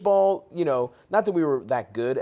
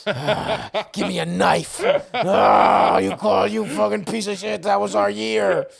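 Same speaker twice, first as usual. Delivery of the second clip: loud, higher, hammed-up drunk fan yelling; rabid Ravens fan; 5 semitones higher, 5 dB louder, slower than usual. Retelling holding Edelman's ball, mock furious fan yell. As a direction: unleash the sore Ravens fan inside. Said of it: "the fucking mongoloid Ravens fan kicks in".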